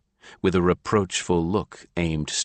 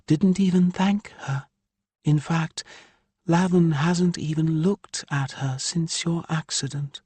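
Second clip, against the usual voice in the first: higher woman's voice, aggrieved; Ilya's voice; 11 semitones higher than usual. A narrator's reading. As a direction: voice the exasperated mother Ilya. Narrator voices Ilya.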